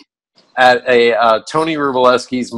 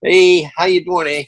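put-on Canadian accent; 4 semitones higher than usual. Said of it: "across the Canadian border".

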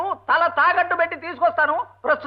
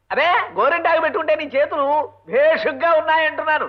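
ordinary talk, nothing marked

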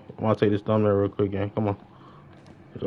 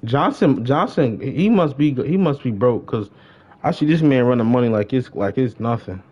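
ordinary delivery

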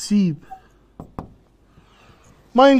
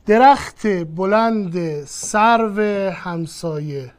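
slow, deliberate example read; slowly pronouncing درخت بلند سرو همسایه; 2 semitones lower, 4 dB quieter, much slower than usual. Shown as a demonstration. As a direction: set each word down slowly for students. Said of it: "پونزده تا وابسته بهش اضافه کن".

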